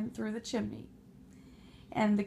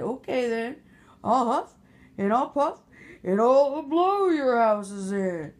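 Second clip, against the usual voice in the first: big bad wolf; 8 dB louder than usual. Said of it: "that big bad wolf said".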